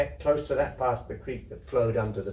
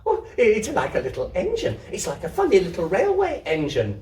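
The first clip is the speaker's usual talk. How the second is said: high, delighted squeal; girl squealing with delight; 6 semitones higher, 5 dB louder than usual. Acted. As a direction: squeal it like a thrilled little girl. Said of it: "squealed Isabel".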